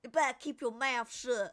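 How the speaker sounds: tough-guy Italian-American mob accent, hammed up; Italian-American mobster accent. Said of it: "I kinda repeated what he said in the accent".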